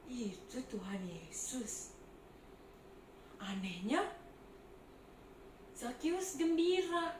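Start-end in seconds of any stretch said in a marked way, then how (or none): 0.1-1.9 hushed whisper in wonder; awed whisper. Acted. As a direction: breathe it out in amazement. Spotting Jesus from the tree.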